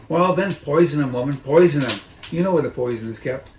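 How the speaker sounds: impatient order. Acted. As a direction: bark the order down. Mrs Bean snaps orders.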